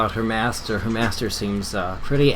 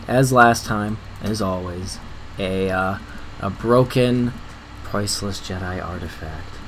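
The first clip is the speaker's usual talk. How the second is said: normal talking voice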